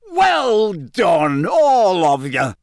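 high, drawn-out hearty cheer; jovial congratulation. A narrator's reading. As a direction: stretch it out with delighted showmanship. Bagman bounces in, looking very pleased.

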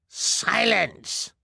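high sharp command. Acted. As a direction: shut him up harshly. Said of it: "commanded one of the meanest-looking".

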